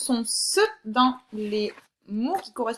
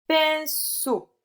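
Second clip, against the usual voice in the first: pinceau split as pin-ceau, pitched up; syllable by syllable; 6 semitones higher, 4 dB louder than usual. Shown as a demonstration. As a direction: say pinceau in two held syllables. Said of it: "dans quelle syllabe tu entends le son S".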